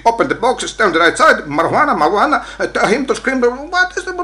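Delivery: high, rattled-off officer commands; mock police orders. Immigrant mimicking police tyrannizing him with English commands, mock bossy. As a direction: parody officials firing orders in English, running into babble.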